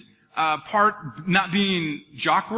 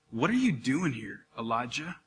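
very soft, low, gentle question; gentle whisper; 7 semitones lower, 8 dB quieter than usual. Retold or imitated. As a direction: ask it tenderly, dropping almost to a whisper. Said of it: "God kinda said it like that".

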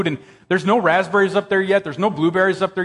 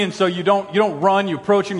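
ordinary talk

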